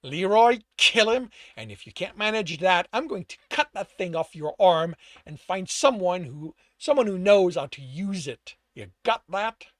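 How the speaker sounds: loud, forceful threat; growled order. A narrator's reading. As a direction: order an underling about angrily. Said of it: "The rat growled".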